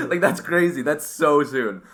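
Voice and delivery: high, loud and laughing; amazed laughing. Real, not acted. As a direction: laugh in disbelief. Laughing in disbelief.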